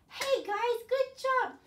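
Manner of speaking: high, loud, bright-eyed praise; cheery praise. Real, not acted. Teacher praises the children after the song, high and loud.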